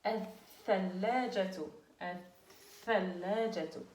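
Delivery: Al-thallajatu drawn out slowly with a doubled th; slow model word. Shown as a demonstration. Teacher models the word slowly for the class. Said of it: "Elle est doublée".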